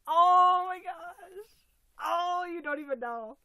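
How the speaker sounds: slow loud high cry of joy; loud delight. Real, not acted. Opening another gift, slow loud cry of joy. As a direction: stretch it out, overwhelmed.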